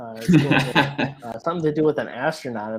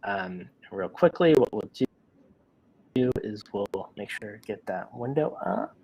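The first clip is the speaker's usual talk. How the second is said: ordinary delivery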